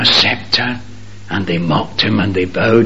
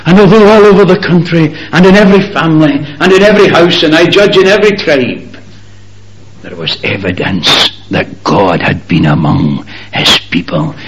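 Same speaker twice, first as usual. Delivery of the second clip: fast, loud surge of preaching; fervent preaching; 6 semitones higher, 5 dB louder than usual. Acted. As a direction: rush it out with pulpit fire. Preacher surging through a list.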